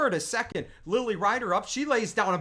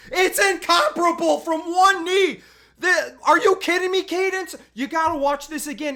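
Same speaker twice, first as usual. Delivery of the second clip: high, loud, disbelieving cry; can't believe that throw, shout it out; amazed yelling; 7 semitones higher, 7 dB louder than usual. Real, not acted. Dad calling his daughter's play, amazed and loud.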